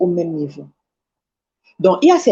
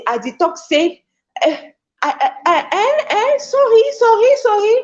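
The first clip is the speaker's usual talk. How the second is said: mock flustered English beginner; 13 semitones higher, slower than usual. Preacher mocks a timid learner's first English, giggling high. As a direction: pitch jumping way up, giggling through nervous sorries.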